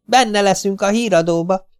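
put-on high, eager boy's voice; excited little boy. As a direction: bubble over about being on the news. Domi excited about TV. (magas, izgatott kisfiúhang)

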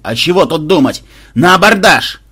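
loud, high rallying shout; crazed battle cry. A narrator's reading. Mad-eyed commander orders boarding, shouting. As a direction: roar the charge with reckless zeal. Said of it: "Глаза Банзая безумно сверкнули".

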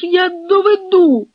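high, loud, drawn-out cry; wailing insistence; desperate plea. A narrator's reading. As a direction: beg at full pitch to be believed. Officer begs to prove himself.

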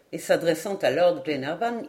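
ordinary delivery, nothing marked

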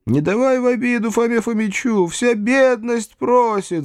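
slow, drawn-out pleading cry; rising high, long-drawn lament; peasant crowd cry. A narrator's reading. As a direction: implore like a wailing crowd. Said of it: "закричали ещё раз мужики".

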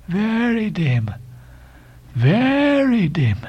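high, very drawn-out echo; Elijah's voice. A narrator's reading. Elijah echoing the sailor's answer, slow and high. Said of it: "said Elijah".

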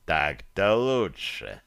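low, slow, amused; smirking demon. A narrator's reading. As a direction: chuckle it out darkly. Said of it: "усмехнулся слуга тёмного бога".

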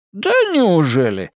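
lightly voiced feigned surprise; mock surprise. A narrator's reading. Editor teasing Anna about the job, feigned surprise. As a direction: pretend to be amazed. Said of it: "наигранно удивился тот".

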